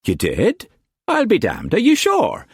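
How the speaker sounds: high, gushing flamboyant painter; expansive Quantz. A narrator's reading. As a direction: gush with delight, pitched high. Quantz voiced in a novel.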